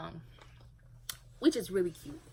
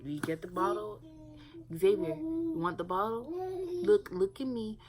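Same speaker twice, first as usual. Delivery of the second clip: put-on higher, louder coaxing baby talk, rising to the end; 7 semitones higher, 4 dB louder than usual. Shown as a demonstration. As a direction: coax the toddler into looking at you. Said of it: "you'll be like".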